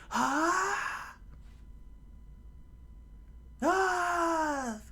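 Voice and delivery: very high, long pained scream. Acted. Luffy screams after stabbing his cheek, very high shriek. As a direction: shriek it out in pain. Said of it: "Luffy screamed".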